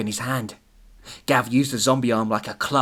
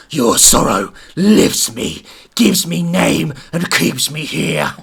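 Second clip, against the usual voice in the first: slow, eerie spirit voice; lightly voiced haunting spirit; mysterious voice; 2 semitones higher, 8 dB louder, slower than usual. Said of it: "mysterious voice".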